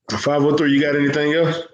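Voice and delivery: usual speaking voice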